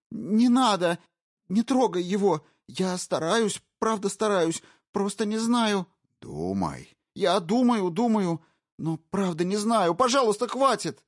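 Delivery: high, loud, frantic begging; terrified pleading. Acted. Wife begging the intruder to stop, high frantic terror. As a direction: beg for his life in panic.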